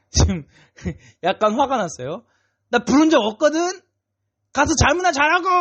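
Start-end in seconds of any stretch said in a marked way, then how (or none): 2.7-5.5 put-on high, loud irritated yell at the boy; annoyed shout. Old priest yelling at Samuel to go back to sleep. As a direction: snap at him, woken up again. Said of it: "아마 두 번째는 좀 소리를 지르지 않았을까".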